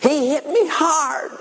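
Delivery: put-on high, slow, whiny little girl voice; little girl wailing that she was hit; little girl complaining. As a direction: whine it like a hurt little sister. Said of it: "And Margaret said".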